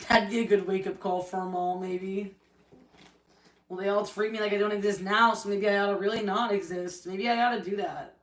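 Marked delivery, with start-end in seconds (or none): none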